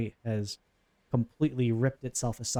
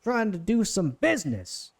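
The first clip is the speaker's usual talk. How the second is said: put-on higher, scolding New Jersey accent; put-on New Jersey housewife, slowing as she grumbles; 7 semitones higher than usual. Boss woman scolds her crew before leaving, New Jersey accent. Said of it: "like, a housewife of New Jersey".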